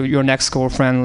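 normal talking voice

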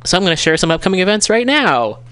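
high-pitched, rising to a peak at the end; voice climbing octaves. Real, not acted. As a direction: let the voice climb up and up as you announce. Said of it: "my voice goes up a few octaves".

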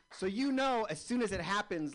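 high, loud burst of excitement; excited. Real, not acted. Comic reliving the gun in his face, excited burst. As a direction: launch back into the tale all fired up.

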